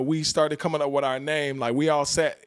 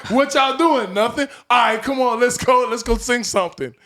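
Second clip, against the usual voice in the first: loud, higher hyped-up call; excited egging on; 7 semitones higher, 5 dB louder than usual. Retold or imitated. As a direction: pump them up to sing. Egging friends on loudly.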